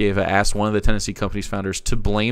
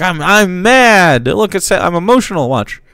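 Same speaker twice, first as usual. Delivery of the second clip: mocking fake outrage; 6 semitones higher, 8 dB louder than usual. Mocking a pundit who fakes being mad on camera. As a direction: ham up a pundit faking anger. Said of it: "Is he trying to do a fa".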